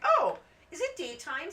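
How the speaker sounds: high, loud eager puppy voice. Retold or imitated. Puppies waking as she gets to bed, perky puppy voice. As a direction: pipe up like an excited pup. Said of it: "the puppies were like".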